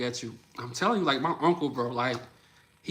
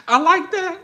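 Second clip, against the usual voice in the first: much higher and louder, still giggly; delighted approval; 9 dB louder than usual. Real, not acted. Praising a viewer's comment, high and delighted. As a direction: say it like the comment made your day.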